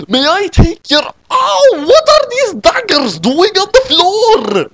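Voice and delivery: a squeaky waiter stepping on daggers; high waiter voice. Acted. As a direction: waiter yelping mid-sentence in a high voice. Waiter character voice.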